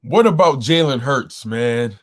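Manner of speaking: ordinary talk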